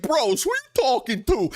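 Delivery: high, mocking imitation; mocking the rival's brother on a live stream; mock callout. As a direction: sneer it out. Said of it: "be like".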